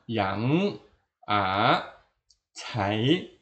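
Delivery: YANG, A, CAI rising from low; second tone. Shown as a demonstration. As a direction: lift each of YANG, A, CAI upward. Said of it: "ini bunyi kedua". (YANG, A, CAI nada kedua, naik)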